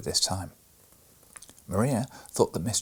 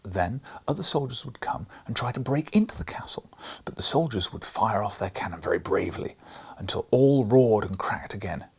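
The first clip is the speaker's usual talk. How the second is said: ordinary delivery, nothing marked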